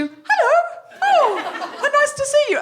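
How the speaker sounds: bright, high, overly sweet hello; sudden high sing-song politeness; fake cheery greeting. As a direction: switch instantly to polite public charm. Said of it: "change my face from hysterical ... to".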